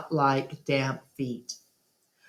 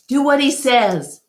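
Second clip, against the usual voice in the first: urgent plea; 8 semitones higher, 5 dB louder than usual. A narrator's reading. Gorilla pleads with elephant.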